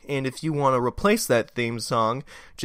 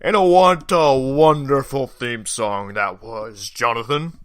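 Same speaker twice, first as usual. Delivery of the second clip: booming ho-ho-ho, then drawn-out jolly greeting; big, loud festive voice easing into slow praise; Santa; 3 semitones higher, 11 dB louder, slower than usual. Acted.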